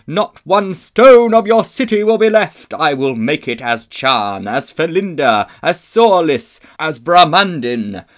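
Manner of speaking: loud, high, exultant proclamation; clear ringing witch voice. A narrator's reading. Witch threatening to conquer the world, ringing triumphant shout. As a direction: ring out like a great bell. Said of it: "came the voice of the witch, clear like a great bell".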